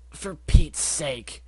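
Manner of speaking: slow, dragged-out exasperation; exasperated cry. Acted. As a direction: groan it out in frustration. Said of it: "Rainbow cried out".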